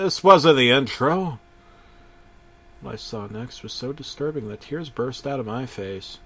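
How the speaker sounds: usual speaking voice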